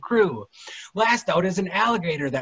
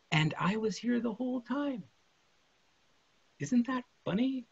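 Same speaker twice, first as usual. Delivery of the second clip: tender; 6 semitones higher, 8 dB quieter than usual. Acted. Dead mother speaks tenderly.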